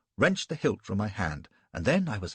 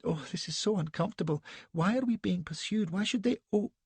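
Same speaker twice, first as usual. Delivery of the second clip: much higher, fretful lady voice; Elsbeth's complaint; 10 semitones higher than usual. A narrator's reading. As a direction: fuss about the ride.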